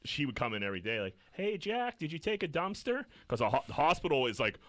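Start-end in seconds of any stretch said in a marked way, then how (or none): 1.3-3.0 high-pitched imitation of an old lady; old lady visitor. Old lady visiting patient daily, mock-chirpy.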